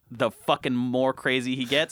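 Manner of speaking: usual speaking voice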